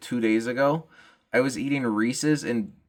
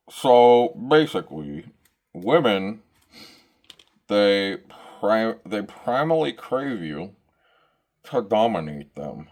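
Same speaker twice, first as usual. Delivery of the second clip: Andrew Tate impression; slower than usual. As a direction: play the smug alpha podcaster. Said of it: "if you've never seen Andrew Tate speak".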